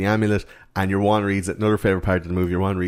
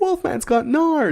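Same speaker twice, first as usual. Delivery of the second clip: very high, drawn-out astonishment; hammed-up falsetto discovery; mimicked amazement; much slower than usual. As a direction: squeal it like an amazed kid. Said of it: "It's like".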